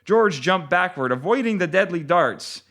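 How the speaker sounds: usual speaking voice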